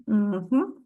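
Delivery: ordinary talk, nothing marked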